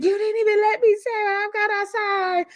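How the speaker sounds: high, put-on whining voice; mock whiny cast member. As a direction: whine it up high like she's upset. Voicing Summer crying upset.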